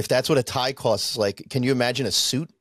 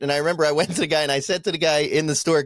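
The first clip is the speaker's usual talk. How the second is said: ordinary talk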